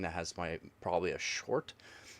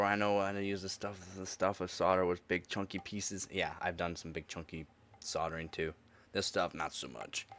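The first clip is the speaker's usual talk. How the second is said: ordinary delivery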